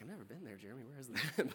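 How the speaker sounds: very soft, puzzled aside; barely voiced comic aside; hushed mock confusion. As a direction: mutter it as a baffled listener. Voices puzzled listeners' quiet aside.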